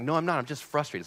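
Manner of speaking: high, quick-fire retold protest; acted-out insistent comeback; defensive denial. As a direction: jump in high with a mock-stubborn denial. Retold argument, denies being angry.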